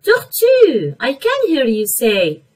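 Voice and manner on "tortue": high, loud call of the word; loud model word. Shown as a demonstration. Teacher calling out a word for the kids, high and loud. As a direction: ring out the word for the class to hear.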